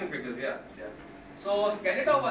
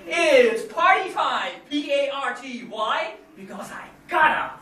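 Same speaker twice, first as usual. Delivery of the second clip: higher, much louder, slow manic shout; The Mask voice; 6 semitones higher, 9 dB louder, much slower than usual. Retold or imitated. Mask hypes himself in mirror with manic shout. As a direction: shout it like a manic cartoon showman. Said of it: "goes in front of the mirror and says".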